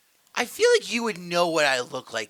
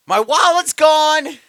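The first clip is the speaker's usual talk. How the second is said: high, loud, drawn-out yell; hammed-up high cry of alarm; mock screaming; 11 semitones higher, 13 dB louder, slower than usual. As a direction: scream out the text in mock panic. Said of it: "because I'm screaming".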